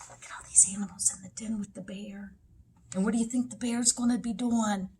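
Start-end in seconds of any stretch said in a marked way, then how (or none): 0.1-2.3 put-on quick excited whisper to children; hushed whisper. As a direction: whisper so the sleeping bear won't hear. Hushed aside about sleeping bear.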